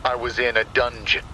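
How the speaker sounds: plain reading voice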